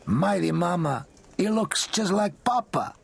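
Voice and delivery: slow, high friendly uncle; lightly voiced warm uncle; lightly voiced big man. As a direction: marvel at the boy's likeness. Santo's voiced lines.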